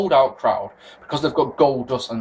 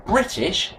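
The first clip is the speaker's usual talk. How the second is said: British in a careful, well-educated English accent; decent educated accent; 4 semitones higher than usual. Shown as a demonstration. Host correcting himself after a slip, decent educated accent. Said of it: "with a decent accent and good education".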